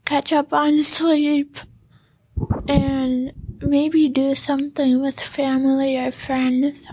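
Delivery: ordinary talk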